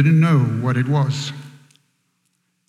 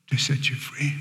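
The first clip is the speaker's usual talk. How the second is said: near-whisper, much softer; hushed; 8 dB quieter than usual. Real, not acted. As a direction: drop to a reverent whisper for the point. Hushed climax of the sermon.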